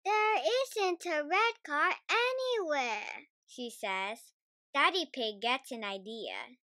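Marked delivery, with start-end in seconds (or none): 0.0-3.3 high little piggy voice, let down; a small girl sulking that no red car comes; disappointed Peppa. A narrator's reading. Peppa complains to her family, high and let down. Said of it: "Peppa is disappointed".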